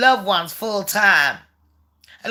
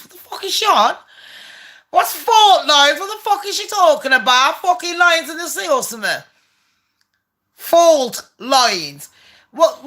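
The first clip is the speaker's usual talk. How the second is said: high, incredulous rant; can't believe what she's reading; outraged disbelief; 5 semitones higher than usual. Real, not acted. Ranting at Megan's letter, high and incredulous.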